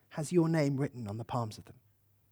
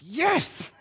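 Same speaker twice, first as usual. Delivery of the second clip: laughing yes; 8 semitones higher than usual. Retold or imitated. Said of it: "He laughs and he cries".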